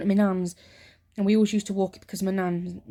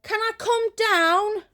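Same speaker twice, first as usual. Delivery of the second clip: put-on high, slow childish plea; pleading child; 13 semitones higher, 3 dB louder, slower than usual. Young self begging mum to come downstairs, high plea. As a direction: beg like a little girl. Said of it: "I'm like".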